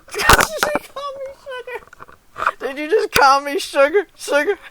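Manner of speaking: high, loud incredulous laughing question; amused disbelief. Real, not acted. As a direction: squeal it in delighted disbelief. Laughing, amazed at being called sugar.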